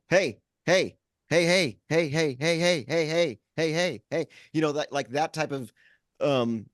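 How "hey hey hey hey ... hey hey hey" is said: raised, insistent repeated heys; insistent nagging. Retold or imitated. Mimicking the intrusive TV while fixing dad's computer, insistent nagging. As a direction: imitate a relentless attention-grabber.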